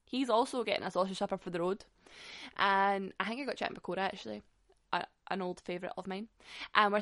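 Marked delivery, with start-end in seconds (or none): none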